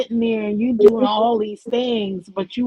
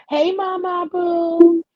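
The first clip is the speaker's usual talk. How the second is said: excited greeting; 9 semitones higher, 3 dB louder, slower than usual. Real, not acted. Greeting chat viewer warmly.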